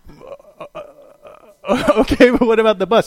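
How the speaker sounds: high, loud nervous laughing stammer; startled hesitation. Retold or imitated. Friends balking at walking the bridge, nervous laughing stammer. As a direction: stammer it out, caught off guard and laughing.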